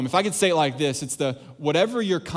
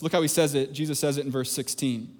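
usual speaking voice

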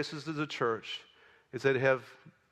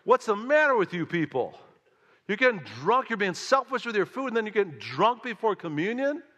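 exasperated, loud Yiddish-flavored scolding; yiddish accent; 7 semitones higher, 5 dB louder than usual. Retold or imitated. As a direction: play up the Yiddish accent, higher and louder.

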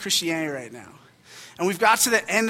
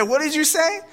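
high-pitched incredulous demand; mock indignation; 10 semitones higher, 3 dB louder than usual. Dad confronting a preschooler who insulted his daughter, mock indignation. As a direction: challenge it in a rising, offended voice. Retold confrontation with rude child.

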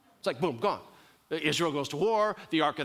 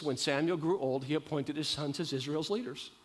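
normal talking voice

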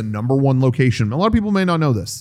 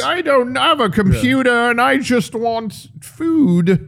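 put-on confused old woman; 8 semitones higher, slower than usual.

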